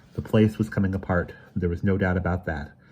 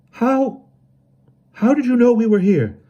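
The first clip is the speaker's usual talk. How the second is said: weak voice; 10 dB louder than usual. A narrator's reading. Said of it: "asked Emerson in a weak voice".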